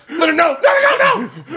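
high, shouted rapid-fire refusals; panicked warning. Retold or imitated. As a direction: cry out to stop a crash. Re-enacting panicked shouts before crash.

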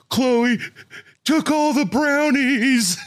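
high, slow mock whiny kid; crying son. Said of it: "What is going on?".

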